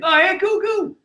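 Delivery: put-on very high, delighted kid voice; little boy. Young son reacting to dad's podcast guest, very high kid voice. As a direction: pitch right up like a thrilled small boy. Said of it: "I went, "Yeah, [name]".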